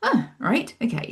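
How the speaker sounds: the penny dropping, half-mock; retold dawning surprise. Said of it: "So I was like".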